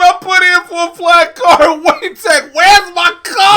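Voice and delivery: high, loud indignant yell; hammed-up angry rant; mock outraged customer. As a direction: demand it like a cheated buyer.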